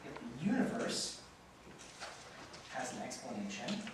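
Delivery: slow and soft, long pauses between phrases; slow dictation. Shown as a demonstration. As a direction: dictate it for them to write down. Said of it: "Say with me".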